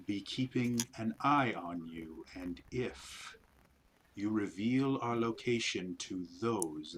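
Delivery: slow, hushed alien creature voice; eerie spider voice. Acted. GM voicing the spiders to the party, slow eerie creature. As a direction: speak like an ancient hive creature, slow and cold.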